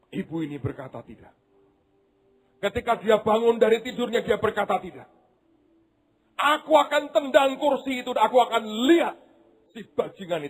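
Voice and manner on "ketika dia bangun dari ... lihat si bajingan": mother vowing vengeance; vengeful vow. Acted. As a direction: swear revenge on the killer. Vengeful mother, preached high.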